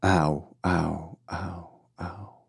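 low repeats fading to almost nothing; strange echo. A narrator's reading. Last word echoing through the chamber, fading echo. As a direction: let each repeat die away like an echo. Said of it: "echoed strangely through the chamber".

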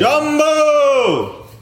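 loud, high, long-held holler; calling the dog. Acted. Owner calling a runaway dog, loud drawn-out holler. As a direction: holler for a dog that has run off. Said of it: "when you wanted to call the dog".

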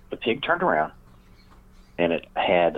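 ordinary delivery

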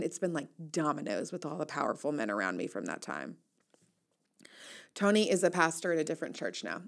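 ordinary talk

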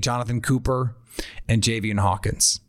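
ordinary talk, nothing marked

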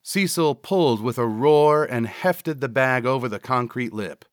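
usual speaking voice

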